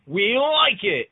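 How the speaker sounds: loud, high, drawn-out approval; hammed-up delighted approval, slowly stretched; company's mock verdict. Friend imitating Nintendo approving the voice actor, mock delight. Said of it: "And Nintendo said".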